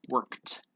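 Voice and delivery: "Worked" ending in a crisp t; final T sound. Shown as a demonstration. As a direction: say "Worked" as one syllable, workt. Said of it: "Well, with a T".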